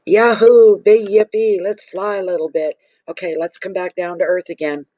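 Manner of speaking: high, loud yahoo that settles down; whooping cheer. Retold or imitated. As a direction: voice the cards cheering, then calming. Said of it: "It's like".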